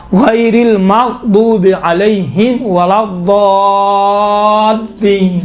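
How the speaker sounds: drawn-out, sustained teaching recitation; slow Quranic recitation. Shown as a demonstration. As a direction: recite very slowly with a long held ولا الضالین. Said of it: "تو مغ نہیں ہے".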